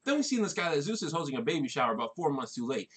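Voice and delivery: normal talking voice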